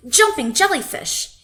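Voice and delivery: loud, high cry of dismay; groaned exclamation. A narrator's reading. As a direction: blurt it out in dismay. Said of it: "he groaned".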